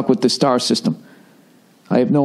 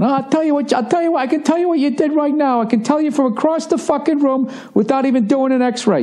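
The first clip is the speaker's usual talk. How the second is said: much higher, put-on voice of a know-it-all doctor; brash doctor imitation, pitched way up; cocky clinic doctor. Mimicking the walk-in clinic doctor's brash diagnosis, much higher. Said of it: "the guy looked at it going".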